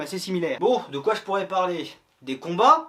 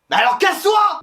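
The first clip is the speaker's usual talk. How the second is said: much higher and louder, yelled dismissal; mock furious shout; 12 semitones higher, 10 dB louder than usual. Host yelling at the voice-off to leave, mock furious. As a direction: blow up and yell him out.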